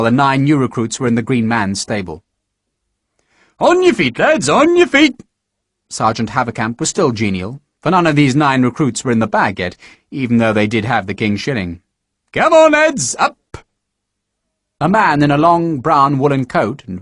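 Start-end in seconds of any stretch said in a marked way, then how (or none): 3.6-5.2 high, loud morning call; rousing shout. Acted. Sergeant rousing recruits. 12.3-13.4 high, loud morning call; rousing shout. Acted. Sergeant rousing recruits.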